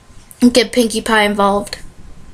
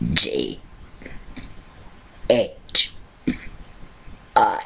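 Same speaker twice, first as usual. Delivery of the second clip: burped letters, very low and rough; burping the words; 10 dB quieter, slower than usual. Shown as a demonstration. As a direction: belch each letter out. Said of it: "I'm going to try burping the A B Cs".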